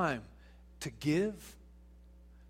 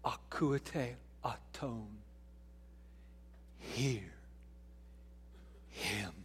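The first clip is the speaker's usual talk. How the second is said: very slow, soft and solemn; hushed voice of God; 8 semitones lower, 12 dB quieter, much slower than usual. Retold or imitated. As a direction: let each word fall slowly, softly. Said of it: "heard the voice of God say".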